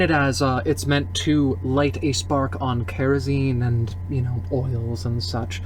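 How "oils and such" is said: usual speaking voice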